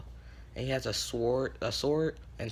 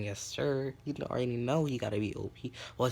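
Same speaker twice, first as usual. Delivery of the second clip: usual speaking voice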